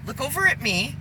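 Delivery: ordinary talk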